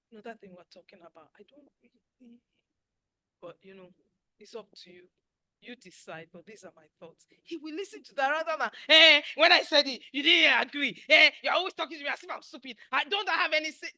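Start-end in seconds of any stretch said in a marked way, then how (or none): 0.1-7.3 gentle soft suggestion. Shown as a demonstration. 8.9-13.9 louder, higher, fast complaining; a shrill-free, loud grumbling wife; nagging complaint. Shown as a demonstration. Nagging spouse contrasted.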